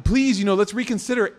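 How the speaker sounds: high, pleading advisor voice; high nervous plea from the advisor. Retold or imitated. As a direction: beg him to reconsider, high and loud. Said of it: "and so he's like".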